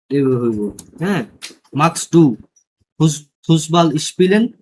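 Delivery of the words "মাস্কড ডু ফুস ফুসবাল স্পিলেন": loud model sentence. Shown as a demonstration. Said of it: "এভাবে বলা যায়".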